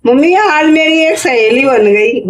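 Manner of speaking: laughing as she voices her happy daughter; chirpy, pleased little girl's news, with a laugh; happy child quote. Retold or imitated. Mother retelling her daughter's happy news, laughing. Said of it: "ये खुश होके आ रही".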